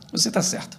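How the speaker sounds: soft, hushed mock surrender; hushed, acted concession; suddenly quiet wife. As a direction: give in very softly as the wife. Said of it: "Ela resolve ficar quieta".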